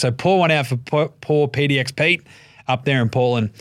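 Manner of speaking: ordinary delivery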